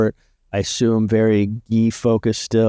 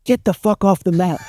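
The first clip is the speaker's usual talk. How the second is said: high-pitched mock yell at an outsider; a pitched-up, hammed-up order to get off the mat; retold yell; 9 semitones higher than usual. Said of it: "starts yelling at Owen".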